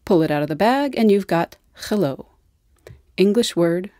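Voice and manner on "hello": hello in a Russian accent, h as a throaty kh. Shown as a demonstration. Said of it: "Russian accent".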